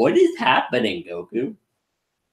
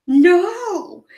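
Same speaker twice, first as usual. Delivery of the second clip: high, flat-out mock refusal with a laugh; Vegeta refusing; 3 dB louder than usual. Vegeta refusing Goku's fusion idea, high and laughing. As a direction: squeal a laughing no. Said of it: "And he's like".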